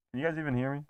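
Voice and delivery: talking inside a helmet; muffled through a mask. Real, not acted. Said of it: "It's hard to see out of this thing".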